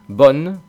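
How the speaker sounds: bonne said as one syllable; northern one-syllable bonne. Shown as a demonstration. Teacher imitating the northern way. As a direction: clip it to one beat. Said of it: "En el norte a lo mejor pronuncian".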